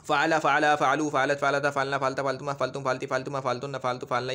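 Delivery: memorised grammar drill, forms strung together without a break; level, continuous reciting of verb forms; rote recitation. Shown as a demonstration. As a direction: run through the conjugation table in an even monotone. Reciting a memorised conjugation table.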